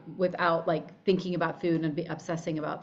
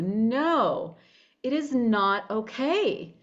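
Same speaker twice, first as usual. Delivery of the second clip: usual speaking voice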